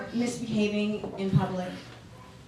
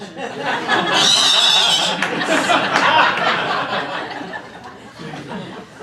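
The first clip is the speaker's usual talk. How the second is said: loud squeaky mock dolphin chatter; dolphin imitation; 8 dB louder than usual. Mom threatens kids with a loud dolphin squeal. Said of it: "I'll do the dolphin imitation".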